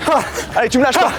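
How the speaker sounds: coach yelling. Real, not acted. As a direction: push him on, shouting. Coach yelling during sprint.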